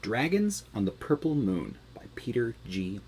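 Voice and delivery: ordinary delivery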